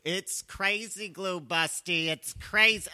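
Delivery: high, drawn-out mock wife; correcting wife. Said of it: "That's what I said, woman".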